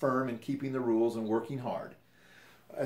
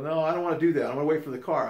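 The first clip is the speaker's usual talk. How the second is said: higher and louder, digging in against his trainer; replaying the companion's defiance, a touch raised; mock defiant refusal; 5 semitones higher, 6 dB louder, faster than usual. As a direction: throw the refusal back at him. Retold quarrel, companion refusing again.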